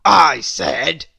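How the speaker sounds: Mister Grumpy snapping at Little Miss Hug; loud gruff snap. A narrator's reading. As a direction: bark it at her before stopping. Mister Grumpy begins angrily.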